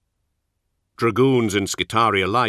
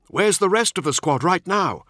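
higher-pitched younger warrior voice; Nemiel; 11 semitones higher, 3 dB louder than usual. A narrator's reading. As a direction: lift the voice for the younger marine.

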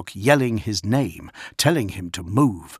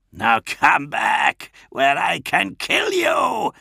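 slow threat climbing high and loud; villain's drawn-out, rising demand; Kurz; 8 semitones higher, 3 dB louder, slower than usual. A narrator's reading. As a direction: stretch out the menace, rising to a cry.

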